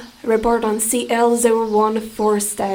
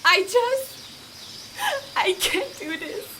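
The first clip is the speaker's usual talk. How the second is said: voice breaking; 7 semitones higher, much slower than usual. Acted. Scientist breaking down, pleading colleague.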